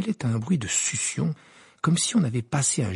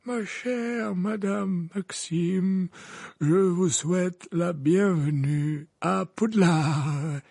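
put-on slow, higher courtly old man; old wizard voice; 7 semitones higher, much slower than usual. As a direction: greet her grandly and slowly. Dumbledore voiced in reading.

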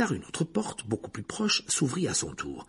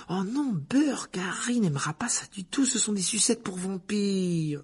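high-pitched, drawn-out disgust; disgusted protest; 10 semitones higher than usual. A narrator's reading. Hermione rejecting vampire lollipops, high disgusted protest. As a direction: recoil with a high, slow whine of distaste.

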